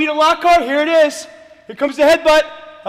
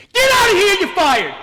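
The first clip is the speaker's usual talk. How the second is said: loud, higher furious dismissal; angry yell; 4 semitones higher, 4 dB louder than usual. Acted. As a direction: bark the firing at him. Firing the assistant.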